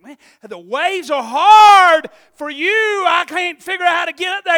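high, loud, slow call; shouting over waves. Retold or imitated. Teen boat driver shouting to his friend on the dock. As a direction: yell across the choppy water to a friend.

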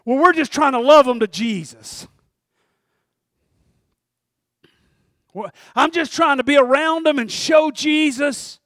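lightly mocking a pious believer; churchy cliché. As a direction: ham up the churchy excuse. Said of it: "We say things like".

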